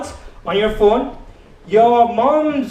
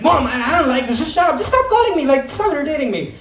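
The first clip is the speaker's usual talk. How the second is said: mock yell at mom; 4 semitones higher than usual. Child yelling at mom, acted.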